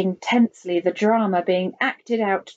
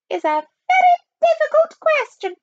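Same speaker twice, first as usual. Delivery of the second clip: very high, loud falsetto spy; 6 dB louder than usual. A narrator's reading. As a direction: stress it in a piercing falsetto. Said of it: "and a falsetto voice".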